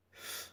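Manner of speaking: a soft breathy f alone; demo f sound. Shown as a demonstration. Teacher isolating the PH sound, soft. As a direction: blow out a quiet f. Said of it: "Su sonido es".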